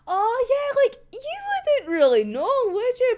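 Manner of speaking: mock Fyodor. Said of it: "Fyodor of all fucking people, just be like".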